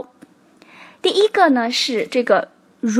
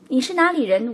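ordinary talk, nothing marked

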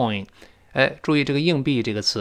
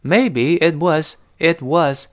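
Maybe it was, slowly and tentatively; slow hesitant demo; hesitant reading; 3 semitones higher, much slower than usual. Shown as a demonstration. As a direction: think while you say it. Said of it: "一边想一边说".

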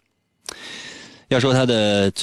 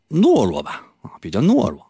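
ordinary talk

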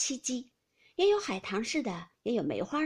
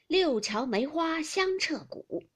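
slow, higher, louder chanting delivery; verse recitation; 6 semitones higher, 5 dB louder, slower than usual. A narrator's reading. Grandmother answering with a rhymed line, slow recitation. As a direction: draw out the verse line with a flourish. Capping verse in drinking game.

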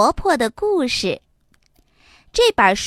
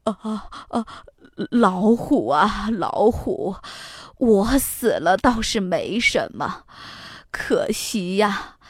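put-on trembling, frightened old woman; shivering; 4 semitones lower, 3 dB quieter than usual. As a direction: quaver out the plea in fear. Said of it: "哆哆嗦嗦地说". (哆哆嗦嗦地说)

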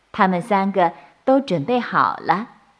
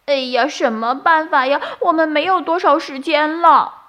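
anxious bunny; 8 semitones higher, 3 dB louder than usual. A narrator's reading.

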